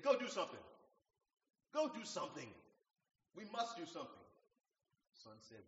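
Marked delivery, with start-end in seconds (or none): 0.0-4.3 commanding. Retold or imitated. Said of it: "waved his hand and said".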